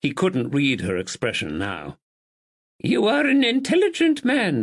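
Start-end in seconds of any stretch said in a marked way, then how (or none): 2.8-4.6 put-on high, stately old lady; elderly lady voice. Narrator voices Lady Anne.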